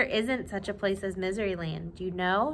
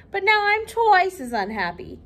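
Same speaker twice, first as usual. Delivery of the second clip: high, louder hammed-up wail; 7 semitones higher, 5 dB louder than usual. The king whining to Little Miss Sunshine, high wail. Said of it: "he wailed".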